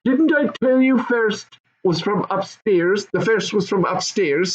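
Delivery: high, insistent goblin father. Acted. Goblin father repeating himself to his wife, insistent. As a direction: insist on it like an annoyed goblin dad. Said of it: "said the other".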